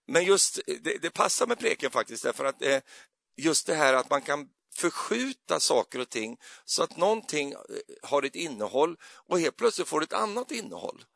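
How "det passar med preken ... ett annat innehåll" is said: normal talking voice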